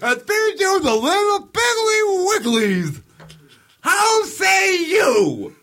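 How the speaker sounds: very high, slow clown croon; creepy clown. Pretend. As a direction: taunt like a demented clown.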